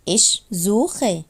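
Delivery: ordinary delivery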